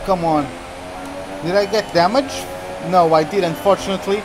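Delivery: ordinary talk, nothing marked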